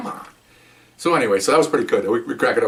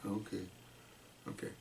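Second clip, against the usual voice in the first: meek giving in; 3 semitones lower, 26 dB quieter than usual. Retold or imitated. Suspect meekly gives in.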